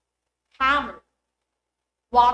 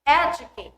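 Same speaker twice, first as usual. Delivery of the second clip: EDucate, ED punched louder; stress on first syllable; 2 semitones higher, 7 dB louder than usual. Shown as a demonstration. Teacher giving the base word, EDucate stressed at the front. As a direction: hit ED in Educate. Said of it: "Let's have this as examples".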